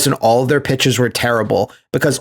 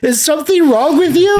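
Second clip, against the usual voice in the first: much higher, hammed-up worried girlfriend; mock Eliza; 12 semitones higher than usual. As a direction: squeak it out like a fretting girlfriend. Retelling Eliza's line in the movie.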